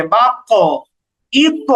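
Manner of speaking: "বাক্য", "ইক্ক" with rounded heavy bounce; qalqalah tilted upward. Shown as a demonstration. Teacher shows qaf and ta going upward, heavy qalqalah. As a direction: colour the echo of "বাক্য" and "ইক্ক" toward o. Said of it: "উপরের দিকে".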